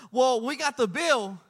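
ordinary delivery

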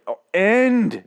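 high, loud pleading yell; begging the show to end, loud; mock scream. Vlogger screaming at his computer, high mock yell. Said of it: "Literally screaming at my computer".

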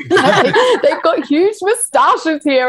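high, slow, laughing gush; giggling high delight. Retold or imitated. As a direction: laugh through the words. Retelling delight at street scene.

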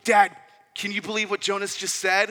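put-on high, loud child calling for Dad; kid calling out. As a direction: call out like an outraged little boy. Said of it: "Liam starts crying out to me. He's like".

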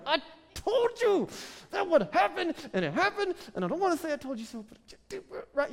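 exaggerated high whine of I told you so; mock told-you-so. Mocking a frustrated advice-giver.

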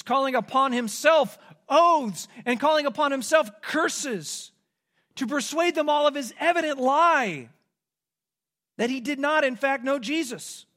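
rising sermon intensity. Real, not acted. Preacher building intensity.

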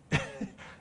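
a short breathy mocking laugh, high. The officials laugh at the backpacker, mocking. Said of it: "they laughed at her".

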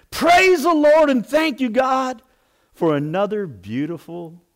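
high, loud ringing praise; joyful praise shout. Retold or imitated. Old lady praising God next morning, loud joyful call. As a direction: cry it out to the heavens.

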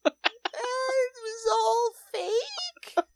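very high, very slow whimper through a laugh; mock whimper. Imitating the anchor after the hoax, slow whining deflation. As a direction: whine it out like a deflated kid. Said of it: "And then it's like".

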